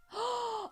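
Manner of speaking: sharp, high intake of breath; excited gasp. Real, not acted. Host remembers a cartoon for her co-host, excited gasp. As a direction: gasp as a great idea strikes.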